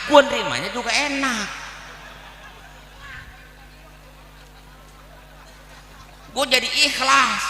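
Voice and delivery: high-pitched bargaining retort; cheeky acted reply stepping up high. Acts out his cheeky reply.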